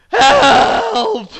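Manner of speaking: loud, high-pitched yelp for help; hammed-up shout for help; cry for help. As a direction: call out as a hurt character, high and loud. GM voices fallen man calling out.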